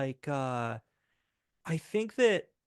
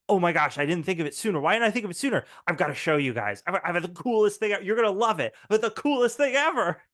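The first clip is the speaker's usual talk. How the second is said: put-on fast, loud excited kid; 4 semitones higher, 5 dB louder, faster than usual. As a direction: gush with excitement. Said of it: "Very excited".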